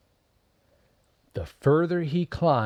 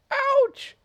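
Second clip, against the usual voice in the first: very high, louder squeaky ouch; tiny mouse yelp; 7 dB louder than usual. Acted. Mouse hurt again.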